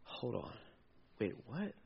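soft, low puzzled aside; Peter, perplexed at the empty tomb; perplexed murmur. Retold or imitated. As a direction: mutter it to yourself, confused.

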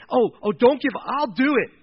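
loud, high, eager volunteering; on fire, passionate. Acted. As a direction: burst out like a fired-up young hero. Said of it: "it's like on fire".

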